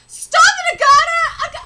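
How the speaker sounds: high, loud shout of a name; frantic shout. Acted. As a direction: scream to stop a friend. Character yells at Agata to stop.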